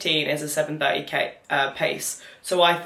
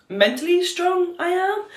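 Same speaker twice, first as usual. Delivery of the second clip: much higher, slow and rising; 13 semitones higher than usual. Real, not acted. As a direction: lift it up high, slowly. Self praise rising high.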